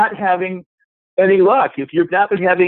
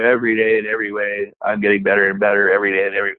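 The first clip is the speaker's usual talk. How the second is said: lower, fast, mechanical rattle; rote repetition; 6 semitones lower, faster than usual. Retold or imitated. Coach mocks someone droning affirmations, mechanical and rushed. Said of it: "if you just sit there going".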